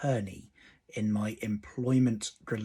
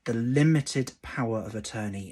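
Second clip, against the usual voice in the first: "limited" punched louder than the rest; stress on LIMITED; 4 dB louder than usual. Real, not acted. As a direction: lean firmly on the word "limited". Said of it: "and I stress the word limited".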